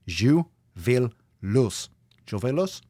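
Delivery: slow, hammed-up German accent. Host turning his own motto into mock German accent.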